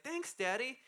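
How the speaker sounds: put-on four-year-old thanking her dad sweetly; little girl voice. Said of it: "she's like".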